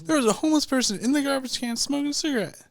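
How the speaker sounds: high, fast, astonished outburst; incredulous. Real, not acted. Man telling friends of his find, incredulous. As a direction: rush it out in disbelief, pitch up. Storyteller exclaims his disbelief.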